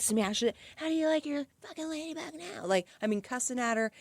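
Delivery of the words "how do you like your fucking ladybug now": higher mocking bully voice; bully boy. Storyteller voices the boy cussing at the little sister, higher and mocking. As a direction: sneer it like a cruel twelve-year-old.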